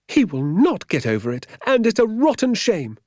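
high, loud protest with NOT stressed; indignant contradiction. A narrator's reading. Woman contradicting doctor over vicar's lost dinner, indignant. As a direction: hit "not" hard, indignantly.

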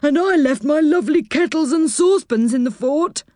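a touch of sorrow in the high Saucepan voice; mildly sad, missing his kettles. Acted. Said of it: "said Saucepan in a sad voice".

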